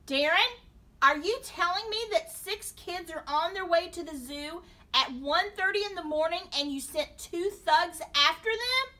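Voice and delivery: loud, high, appalled questioning; horrified father. A narrator's reading. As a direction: demand it in rising alarm. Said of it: "His father was horrified".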